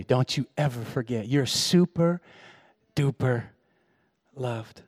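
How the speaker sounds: tender reassurance. Retold or imitated. God's voice speaking tenderly.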